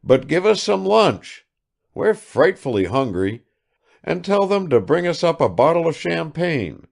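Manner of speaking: put-on high, loud, pushy young man; Sitnikov demanding lunch. A narrator's reading. Sitnikov demands lunch and champagne, high and pushy. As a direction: ask for lunch as if owed it.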